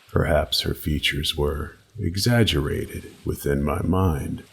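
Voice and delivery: ordinary delivery, nothing marked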